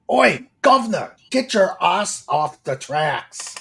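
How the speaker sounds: hammed-up British "Oi, governor" call; mock British yell, drawn out at the end; bad British accent. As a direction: put on a clumsy British accent, loud. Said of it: "my British accent isn't the greatest".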